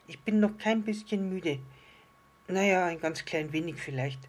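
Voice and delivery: high protest, easing into admission; protesting little star. Acted. Little star denies being tired to the island fish, high and protesting. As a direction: deny it as a small star, then relent.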